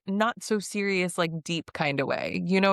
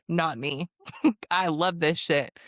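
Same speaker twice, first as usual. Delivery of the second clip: ordinary talk, nothing marked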